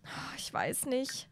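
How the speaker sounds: breathless scared whisper; mother whispering her fear to husband; frightened whisper. Acted. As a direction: whisper it in dread. Mom frightened about full moon.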